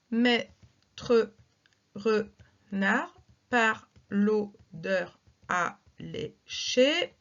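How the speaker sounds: very slow, word by word scansion; slow verse reading. Shown as a demonstration. Teacher reads La Fontaine slowly for syllable counting. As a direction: draw out each syllable so pupils can count. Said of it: "Pas de difficulté majeure".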